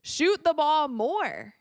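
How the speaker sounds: half-shouted, emphatic fan plea; retold scream. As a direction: imitate screaming from the stands, drawn out. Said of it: "what I was screaming last season".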